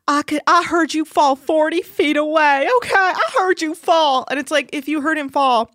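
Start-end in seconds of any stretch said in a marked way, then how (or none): 0.1-4.3 mock Amy. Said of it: "So Amy's like".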